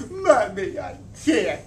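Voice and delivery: a hint of tears as she refuses; tearful refusal. A narrator's reading. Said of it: "The tears really started coming".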